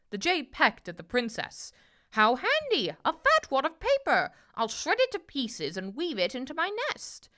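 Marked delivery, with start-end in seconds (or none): 2.1-7.3 high-pitched busy bird; squawking jay. A narrator's reading. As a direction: gloat over a find as a jay. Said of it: "the jay squawked".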